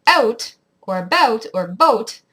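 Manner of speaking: Canadian "out", "about", "boat", accent under the vowel; spaced-out Canadian vowel demo. Shown as a demonstration. Vlogger showing how Canadians say it, Canadian accent. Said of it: "your accent goes under the vowel".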